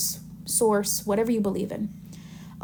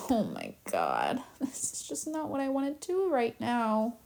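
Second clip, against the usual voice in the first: frustrated God; 9 dB quieter than usual. Acted. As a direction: sigh it out as a worn-down, reluctant God. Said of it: "And God's getting frustrated".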